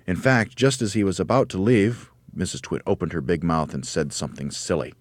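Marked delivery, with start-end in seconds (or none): none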